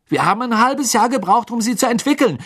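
high, loud indignant yell; shouted. A narrator's reading. Son shouting at his mother, high and loud. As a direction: yell it back at your mother. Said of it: "schrie Fred seine Mutter an".

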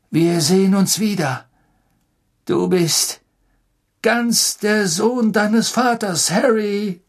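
loud, slow heartfelt farewell; emotional farewell. A narrator's reading. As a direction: say goodbye with pride. Sirius's farewell.

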